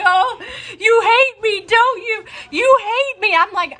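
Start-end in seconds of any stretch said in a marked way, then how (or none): 0.0-2.2 high, wailing mock sob of a drunk colleague; mock crying. Colleague sobbing in the elevator corner, hammed-up wail. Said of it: "She starts crying". 2.5-3.3 high wailing imitation of the sobbing colleague; mock crying. Crying flight attendant accusing her new colleague, high mock wail. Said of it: "She starts crying".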